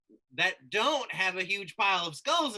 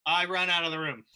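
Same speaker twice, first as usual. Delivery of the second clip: usual speaking voice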